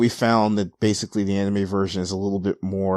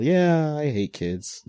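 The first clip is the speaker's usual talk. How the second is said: mock-cheerful high yeah, then dropping off; with a smile; 6 semitones higher, slower than usual. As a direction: smile through the dislike. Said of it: "with a smile".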